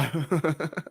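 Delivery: brief burst of giggles; short laugh. Real, not acted. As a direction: laugh off the bad play. Laughs at the team getting beaten.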